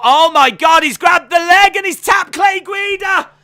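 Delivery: very high, loud shout that cries out at the finish; screaming in disbelief. Real, not acted. Commentator to viewers, screaming as the underdog wins. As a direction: scream it as the fight suddenly ends. Sudden tap-out finishes fight.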